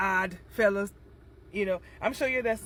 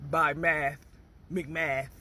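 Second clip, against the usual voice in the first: normal talking voice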